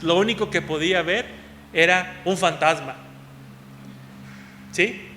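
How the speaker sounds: ordinary talk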